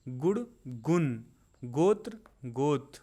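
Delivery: spaced word pairs, each word set apart; unhurried vocabulary drill; slow, deliberate recitation. Shown as a demonstration. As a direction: take your time so learners can say it along. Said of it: "मैं बहुत आराम आराम से बोल रहा हूँ".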